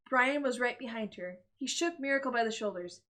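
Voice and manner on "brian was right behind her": ordinary delivery, nothing marked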